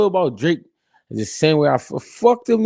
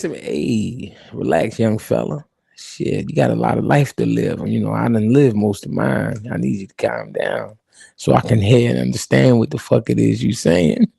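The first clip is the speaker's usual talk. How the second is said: put-on calm old boss; 5 semitones lower than usual. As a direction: settle the kid down gently. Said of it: "Jay Prince".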